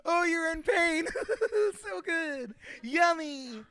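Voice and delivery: high, loud, cackly delight in suffering; gleeful sadist act. Acted. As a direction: savor it with hammed-up villainous glee. Acts out relishing pain, chuckling.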